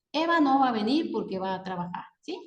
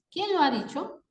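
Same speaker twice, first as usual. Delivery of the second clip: higher pitch, rising question on QUIÉN LO HA DICHO; 6 semitones higher than usual. Shown as a demonstration. Teacher demonstrating an interrogative word, questioning tone. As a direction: ask "Quién lo ha dicho" with a lifted ending. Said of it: "las palabras interrogativas, admirativas y exclamativas".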